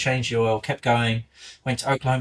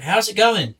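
high-pitched waitress; 6 semitones higher, 4 dB louder than usual. Retold or imitated. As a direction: play the nosy waitress, up high and light. Waitress voiced again.

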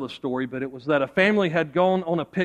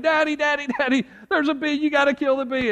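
put-on high-pitched, pleading child; screaming little girl; 9 semitones higher than usual. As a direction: mimic a terrified girl begging her dad. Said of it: "the girl starts screaming".

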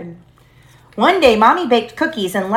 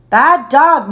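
a touch of a stern scold, louder; mild scolding; 5 semitones higher, 6 dB louder than usual. A narrator's reading. Mother scolding the barking dog, mildly stern. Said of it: "Mommy scolded".

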